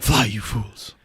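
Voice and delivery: low, soft imitation of a wizard; lightly voiced last words; Gandalf. Wizard hanging from the bridge, hushed low warning. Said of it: "When he's like".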